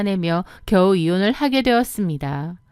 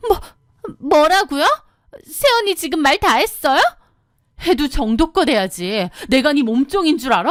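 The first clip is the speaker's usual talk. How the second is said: high, outraged retort; indignant sister-in-law; 5 semitones higher than usual. Acted. Sister-in-law outraged at her brother's wife. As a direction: snap back in disbelief.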